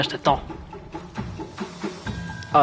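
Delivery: ordinary delivery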